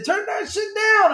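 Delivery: very high, slow shouted parrot voice; mock parrot shouting to turn it down; squawking parrot. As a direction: screech it like an angry parrot. Parrot's line quoted in movie.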